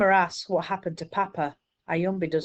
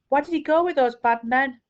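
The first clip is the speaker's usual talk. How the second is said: put-on upset child; 8 semitones higher, 5 dB louder than usual. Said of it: "I start to cry".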